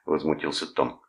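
ordinary delivery

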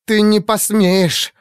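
slow, high hissed fury; through gritted teeth. A narrator's reading. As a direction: force it out through clenched teeth. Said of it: "процедила сквозь зубы Бернардин".

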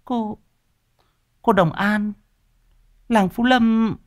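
high, halting female voice; stunned recognition. A narrator's reading. Wife realizing whom they hit, stunned echo. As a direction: echo the name in astonished falsetto.

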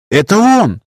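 high excited cry; exclaiming. A narrator's reading. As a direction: blurt it out, pointing. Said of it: "воскликнула Света".

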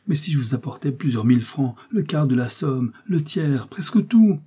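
rising, building plea; escalating entreaty, pitch climbing to the end; desperate pleading. Acted. Emma bargains desperately, offer by offer.

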